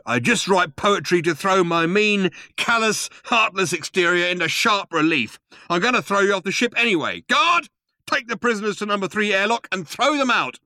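high, forceful rant into an order; rant, then order the guard about; angry commander. A narrator's reading. Vogon captain orders prisoners thrown out.

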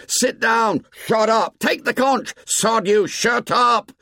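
high-pitched volley of shouted orders; heated, high boyish heckling; angry outcry. A narrator's reading. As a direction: yell like a roomful of boys shouting someone down. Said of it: "the storm broke".

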